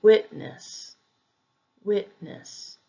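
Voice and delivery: witness with WIT stressed; first-syllable stress. Shown as a demonstration. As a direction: say witness as WIT-ness, falling away. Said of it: "be on the first syllable".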